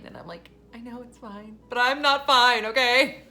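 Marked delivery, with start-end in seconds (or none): 0.7-1.6 small, high, soft reply; mock whimper. Retelling tearful reply to husband. 1.7-3.2 loud, high protest tipping into laughter; hammed-up insistence; mock upset outburst. Acting out upset retort to husband.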